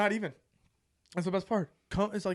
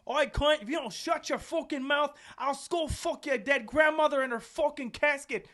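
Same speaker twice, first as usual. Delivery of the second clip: Australian rant; 9 semitones higher than usual. Retold or imitated. Roommate to drunk player outside, hammed-up angry Australian rant. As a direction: yell it out the window in an Australian accent. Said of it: "Tom just randomly just goes".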